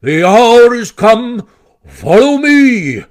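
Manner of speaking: slow, high, loud battle call; full-voiced heroic shout; rallying cry. A narrator's reading. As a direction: cry out to lead comrades into the charge. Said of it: "glanced round at his comrades and cried".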